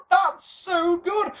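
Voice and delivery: high-pitched laugh into slow, drawn-out praise; laugh, then savour the words slowly; giddy laugh and slow gushing approval; laughing delight. Retold or imitated. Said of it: "And you're sat there going".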